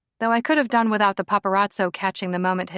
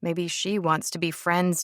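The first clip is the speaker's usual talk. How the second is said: plain reading voice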